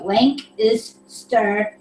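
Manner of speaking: "Lank is stir" in three slow separate pieces; slow syllables. Shown as a demonstration. Practicing a pronunciation guide, slow and separated. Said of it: "but say it fast".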